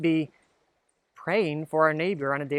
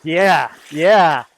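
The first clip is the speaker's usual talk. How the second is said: excited cheer; 12 dB louder than usual. Real, not acted. Cheering the splash.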